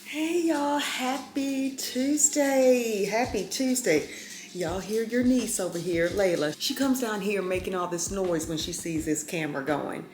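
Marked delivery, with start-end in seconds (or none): 0.0-4.1 high, slow, drawn-out hello; sing-song greeting. Real, not acted. Greeting her viewers at the start, high sing-song.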